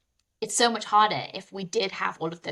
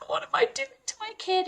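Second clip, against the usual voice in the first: much higher, anxious inner voice; worried mum heart; faster than usual. Retold or imitated. Mother voicing her guilty inner panic about her son, high anxious tone. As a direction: voice the panicked mum inside your head. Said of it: "your mum heart is like".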